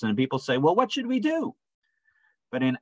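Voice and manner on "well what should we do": very high-pitched acted question; crowd's plea. People asking Peter what to do, high-pitched mock plea. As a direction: imitate a crowd pleading, pitched way up.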